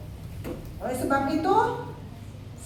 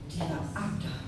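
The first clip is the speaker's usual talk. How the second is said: hushed assurance; 10 semitones lower, 10 dB quieter than usual. Real, not acted. Intimate assurance in sermon.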